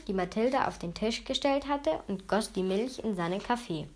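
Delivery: plain reading voice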